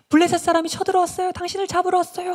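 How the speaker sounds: very high, loud warning shout; acted panicked scream of words; alarmed cry. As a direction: raise the alarm to wake someone. Delilah raises false alarm.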